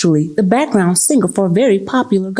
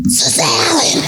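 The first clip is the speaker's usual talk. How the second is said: higher hammed-up tipsy whisper-shout; 10 semitones higher, slower than usual. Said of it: "you start whisper shouting".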